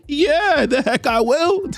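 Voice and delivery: very high, slow and swaggering; over-the-top hunter bravado; cocky villain boast. Acted. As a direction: stretch it out, savoring the boast. Kraven boasts he'll hunt alone.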